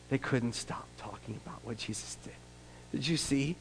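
soft whispered aside; whispered gossip. Acted. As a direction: breathe it like a secret. Hushed aside.

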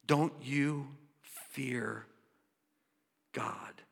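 slow, fading to near-whisper; hushed awe. Acted. Preacher repeats criminal's question reflectively.

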